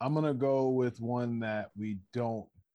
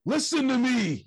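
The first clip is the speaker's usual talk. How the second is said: higher, louder commanding call; mock Bret Hart call; 11 semitones higher, 6 dB louder than usual. Fan imitating Bret Hart addressing the crowd, mock commanding. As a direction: call the room to order. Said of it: "And he's just like".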